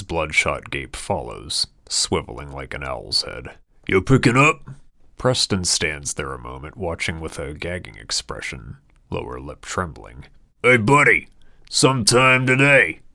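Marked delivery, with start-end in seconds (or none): 3.9-4.7 impatient call. Acted. Waiter calling to Preston. 10.6-13.0 loud, high, impatient waiter shouting; angry yell. Acted. Impatient waiter yelling at Preston.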